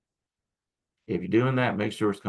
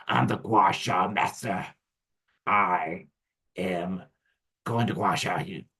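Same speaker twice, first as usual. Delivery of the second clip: mock arrogance; 2 semitones lower, slower than usual. Teacher mocking a pushy gua sha master, slow smug boast. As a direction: puff yourself up like a pompous know-it-all. Mocking a know-it-all practitioner.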